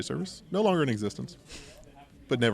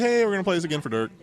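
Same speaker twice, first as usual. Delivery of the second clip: fast, louder mic call-out; mock DJ announcement; 7 semitones higher, 5 dB louder, faster than usual. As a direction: hype the crowd on the mic. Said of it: "we're like".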